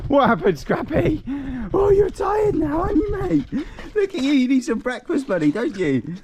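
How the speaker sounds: cooing singsong, pitched right up; doting dog talk. Real, not acted. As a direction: fuss over a tired pet. Baby-talks his tired dog.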